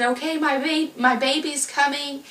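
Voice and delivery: high, eager burst; rising thrill as the baby comes; excited. Retold or imitated. Mother telling herself her baby is coming, high and excited. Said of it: "I was, like, excited".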